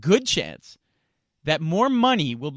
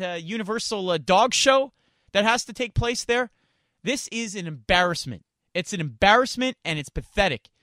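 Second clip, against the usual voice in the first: ordinary talk, nothing marked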